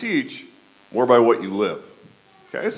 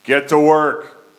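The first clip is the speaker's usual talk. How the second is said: slow, louder call of get to work; a louder, slowed shop-boss "Get to work"; my catchphrase; 6 dB louder, slower than usual. Shown as a demonstration. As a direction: bark "Get to work" slowly and loudly, like a boss to his kids. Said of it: "I must say this all the time".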